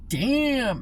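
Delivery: long drawn "damn", pitch swooping up and over; mock stunned onlooker; impressed shock. Onlookers reacting to a coworker being told off, impressed shock.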